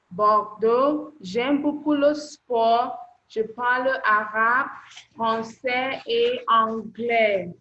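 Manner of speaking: slow, loud and clear, spelling out each French phrase for the class; teacher modelling a self-introduction, slow and loud; model reading. Shown as a demonstration. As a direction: read it out as a model for beginners. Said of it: "You just have to answer these questions".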